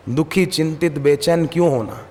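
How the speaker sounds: ordinary talk, nothing marked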